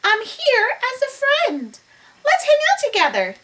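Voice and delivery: high, squeaky-sweet little mouse; peeping mouse. Acted. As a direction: peep a friendly offer to the lion. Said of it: "the mouse peeped".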